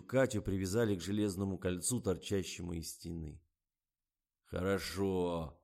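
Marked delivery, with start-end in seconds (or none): 4.4-5.6 slow, drawn-out approval; approving. A narrator's reading. Director approves the tied-up model, satisfied drawl. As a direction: stretch it out with satisfaction. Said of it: "одобрил Фомин".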